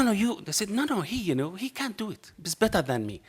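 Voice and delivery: normal talking voice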